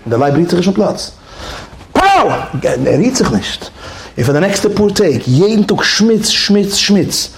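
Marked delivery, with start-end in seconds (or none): 1.9-2.3 high shouted whip crack; trainer's mock whip crack. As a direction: snap it like a whip.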